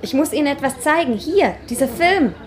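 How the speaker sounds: urgently showing proof; eager. Acted.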